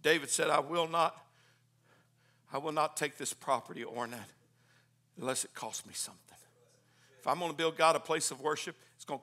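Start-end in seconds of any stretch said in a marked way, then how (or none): none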